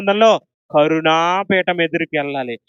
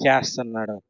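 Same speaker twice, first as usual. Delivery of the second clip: normal talking voice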